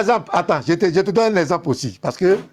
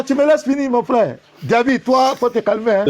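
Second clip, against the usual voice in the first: usual speaking voice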